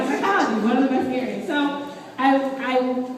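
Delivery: ordinary delivery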